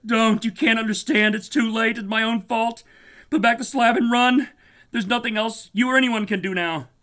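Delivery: very high, loud desperate screaming; scream of despair. Acted. Warren screaming at Carter to run, despair. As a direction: scream that all is lost. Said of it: "a scream of utter despair".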